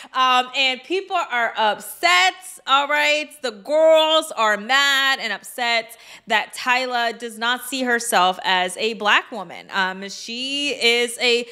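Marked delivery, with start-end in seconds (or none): none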